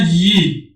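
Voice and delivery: یی sounded out for learners as "yee"; syllable pronunciation demo. Shown as a demonstration. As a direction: say یی as "yee", y gliding into long ee.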